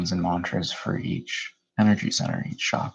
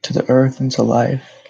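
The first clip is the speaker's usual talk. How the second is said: measured meditation guide, long pauses between phrases; guided meditation; 3 semitones higher, 5 dB louder than usual. Real, not acted.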